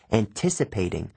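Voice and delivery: ordinary delivery, nothing marked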